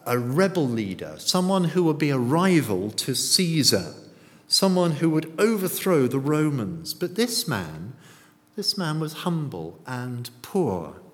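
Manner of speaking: normal talking voice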